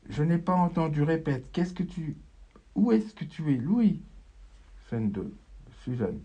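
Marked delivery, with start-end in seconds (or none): 0.1-4.0 mother calling. Acted. Mother searching, calling Louis.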